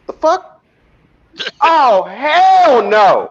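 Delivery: high, drawn-out disgusted cry; appalled outburst. Retold or imitated. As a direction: react like you just saw something gross. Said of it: "you just heard him say".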